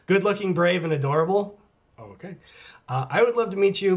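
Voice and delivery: ordinary delivery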